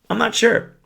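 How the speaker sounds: high-pitched mock-innocent admission; professed uncertainty. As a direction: pitch it way up, coyly unsure. His stock reply, acted out.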